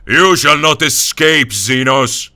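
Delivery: loud, slow, furious battle cry; shout. Acted. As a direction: roar it at the alien. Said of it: "he shouted".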